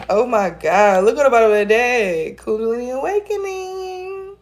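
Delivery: can't believe the card; giddy surprise at the deck; excited disbelief. Real, not acted. Amazed at the card drawn.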